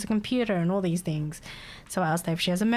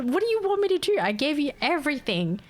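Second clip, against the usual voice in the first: exasperated; 6 semitones higher than usual. Acted. Acting out exasperation at the caller.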